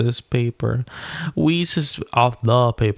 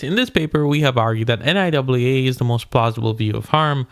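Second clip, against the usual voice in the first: ordinary delivery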